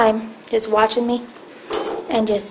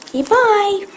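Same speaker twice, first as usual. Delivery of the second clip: high sing-song coax; coaxing the cat; 7 semitones higher, slower than usual. Real, not acted. Owner coaxing her cat to say bye, high coo. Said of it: "coaxing cat to say bye".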